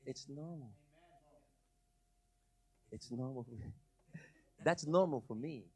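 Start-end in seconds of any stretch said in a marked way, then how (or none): 0.1-3.5 much softer and lower; almost to himself, hushed. Real, not acted. Pastor hushing the congregation gently, very quiet. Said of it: "repeated, each softer".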